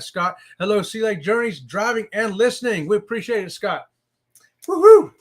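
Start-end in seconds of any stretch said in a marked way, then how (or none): 4.7-5.1 loud, high whoop of joy; excited cheer. Real, not acted. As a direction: let out a big happy whoop. Cheering a viewer on stream.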